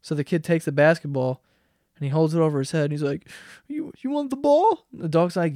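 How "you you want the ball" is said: high-pitched baiting question; coaxing a dog. Retold or imitated. Kid holding ball over dog, high coaxing.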